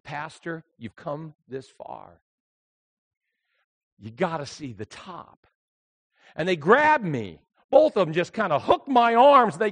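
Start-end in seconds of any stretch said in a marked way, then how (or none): 0.0-5.5 gentle urging. Retold or imitated. Brothers gently urge pastor on.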